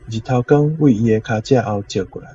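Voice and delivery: ordinary delivery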